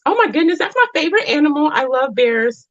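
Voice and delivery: high-pitched gushing delight; real delighted excitement. Real, not acted. Spotting bears, her favorite animal, real squealy excitement.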